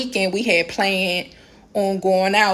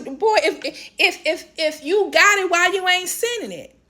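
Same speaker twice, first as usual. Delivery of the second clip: high, sputtering exasperation; incredulous rant; 8 semitones higher than usual. Real, not acted. Woman ranting at a man who won't pay up, incredulous. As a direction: demand an answer in disbelief.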